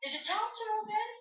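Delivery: high mock yelling coach; mock scream. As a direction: pitch it way up like a furious coach. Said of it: "He's, like, screaming at me".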